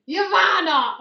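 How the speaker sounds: high, loud call of a name; yell. Acted. Player's character turning round to a runaway friend, yelling. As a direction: shout after someone down the street. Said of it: "I just turn around and yell".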